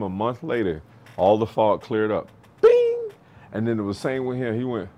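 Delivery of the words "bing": high, loud bing; imitated ding. Coach imitating the fog clearing at once, high ding. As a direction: ring it out like a bell.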